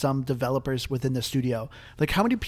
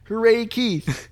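cheering; 8 semitones higher, 6 dB louder, much slower than usual. Real, not acted. Cheering for Keith.

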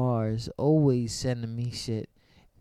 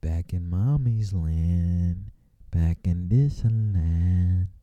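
slow low drawl; 7 semitones lower, much slower than usual. Acted. Slow low musing line.

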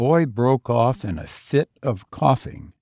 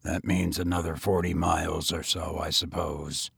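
grim tired tramp, lower voice; grimly; 7 semitones lower, 7 dB quieter than usual. A narrator's reading. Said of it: "he continued grimly".

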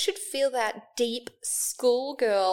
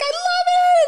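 very high and loud, thrilled; high-pitched gushing; 8 dB louder than usual. Retold or imitated. Hearing the client's news, gushing very high and loud. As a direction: gush like you can't contain it. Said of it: "I got more and more high pitched".

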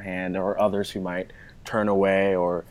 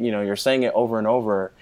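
ordinary talk, nothing marked

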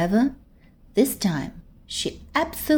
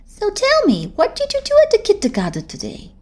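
higher, lively schoolgirl voice boasting to a friend; a proud big-girl voice, raised high; older girl voice; 12 semitones higher, 3 dB louder than usual. Acted. Gabby's voice.

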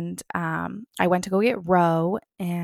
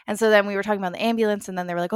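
ordinary talk

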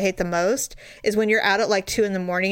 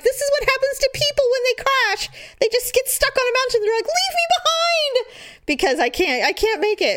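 hammed-up doom; 13 semitones higher than usual. Retold dramatic panic on mountain.

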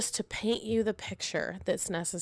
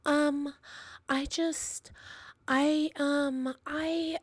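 put-on higher, halting kid voice; drawn-out, high-pitched hemming and hawing; hesitant child; 6 semitones higher, slower than usual. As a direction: imitate a child stalling over a confession. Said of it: "Piper comes in, and she goes".

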